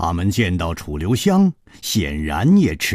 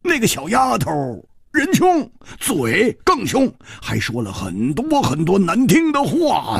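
put-on much higher, spiteful complaint about the girl; resentfully; 13 semitones higher, 3 dB louder than usual. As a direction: spit out the grudge against the little shrew. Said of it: "她恨恨地接着说".

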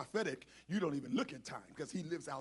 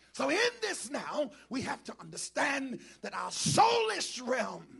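raised, higher-pitched preacher's cry; forceful preaching; 6 semitones higher, 9 dB louder than usual. Real, not acted. Preacher teaching the congregation, forceful and raised. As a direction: drive the point out to the whole church.

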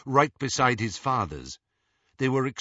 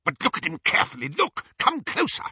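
high, urgent, quick excitement; feverishly eager, pitched high; excited; 14 semitones higher, faster than usual. A narrator's reading. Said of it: "never heard Lucius Malfoy so excited".

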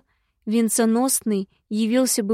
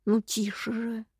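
soft, drawn-out shushing; indignant hushing; 7 dB quieter than usual. A narrator's reading. Imp afraid of waking Tasha, indignant hushing. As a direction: hush him under your breath, indignantly. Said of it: "взмутился ушастый".

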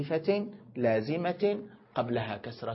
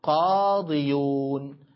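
slow demo; 4 dB louder, much slower than usual. Shown as a demonstration. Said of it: "ستقول مثله تمامًا".

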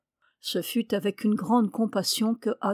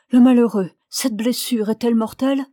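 louder, anguished cry of concern; distressed young woman, voice raised at the start; alarmed; 2 semitones higher, 7 dB louder than usual. A narrator's reading. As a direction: blurt it out in alarm. Young woman alarmed over wounded man.

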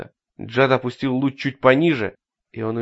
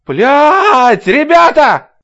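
terrified scream; 12 dB louder, much slower than usual. Acted. Roy screams at monster.

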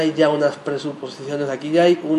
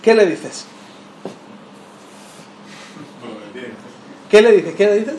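pressing question; 3 semitones higher than usual. Real, not acted. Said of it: "Dime, dime, no, quiero oírlo".

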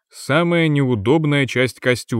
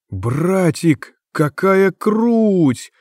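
put-on excited kid sister voice, higher; delighted; 6 semitones higher, much slower than usual. A narrator's reading. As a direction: gasp in delight, drawn out. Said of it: "восхитилась Тика".

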